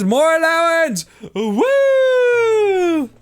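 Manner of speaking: high happy whoop; excited cheer. Real, not acted. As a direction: cheer it loudly. Excited win.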